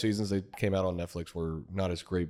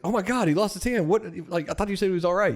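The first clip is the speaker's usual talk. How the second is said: much higher, horrified outburst; shocked mother; 11 semitones higher, 4 dB louder than usual. Retold or imitated. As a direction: gasp at the missing hand.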